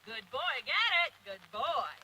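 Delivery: loud excited praise. Real, not acted. Trainer praising Wiggins round the cone, loud excited praise. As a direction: burst out with praise for the dog. Praising dog after the turn.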